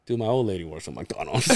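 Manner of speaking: low, soft confidential aside. Retold or imitated. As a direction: drop the voice as if confiding, imitating him. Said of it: "he lowers his voice".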